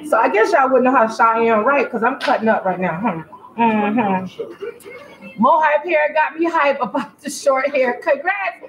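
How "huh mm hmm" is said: ordinary talk, nothing marked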